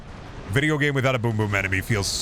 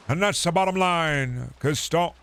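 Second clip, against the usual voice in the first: Stone Cold catchphrase; 5 semitones higher than usual. Pretend. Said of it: "Stone Cold Steve Austin".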